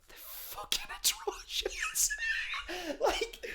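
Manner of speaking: high, laughing whisper; real laughing whisper; laughing disbelief. Real, not acted.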